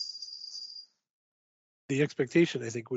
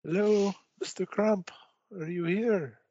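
calling out; 6 semitones higher, slower than usual. Acted. Calling out for Crump.

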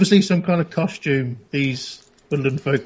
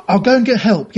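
flustered; 6 semitones higher, 5 dB louder than usual. Acted. Player answers the wailing man, flustered.

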